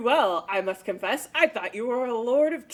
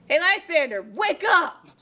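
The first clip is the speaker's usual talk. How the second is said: higher, louder wake-up call; calling him awake; 5 semitones higher, 4 dB louder than usual. Acted. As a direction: rouse the sleeper with a shout. Helena shakes Lysander awake.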